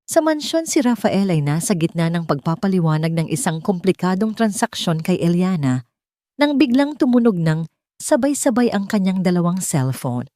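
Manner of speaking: usual speaking voice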